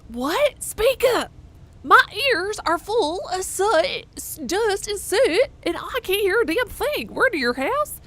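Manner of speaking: put-on high, loud, slow old digger half-deaf; muffled digger voice. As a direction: shout it like your ears are full of soot. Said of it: "sounds like you're talking through a pillow".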